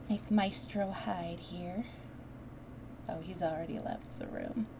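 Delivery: ordinary delivery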